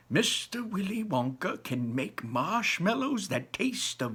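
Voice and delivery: put-on high, lively old man voice; a grandpa bursting with wonder; old grandfather. Grandpa Joe dazzling Charlie with Wonka's inventions, high and lively.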